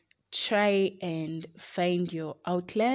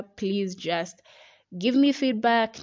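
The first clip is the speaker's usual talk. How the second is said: usual speaking voice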